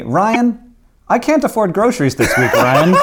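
quoting the friend with a laugh breaking through; mock friend voice. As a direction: ham up the friend's complaint while laughing. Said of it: "One of my friends said to me".

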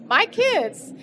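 teary, voice jumps up. Real, not acted. Emotional repeat, voice jumps up.

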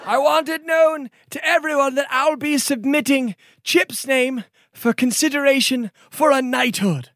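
high, courtly gift speech; formal noble lord. Acted. Noble gives the hero a ring, courtly. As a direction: bestow the ring with ceremony.

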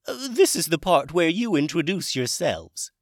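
high, loud dragon character voice; Hamish dragon voice. Acted. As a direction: play a chirpy, high-pitched dragon wizard. Hamish prompts the boys.

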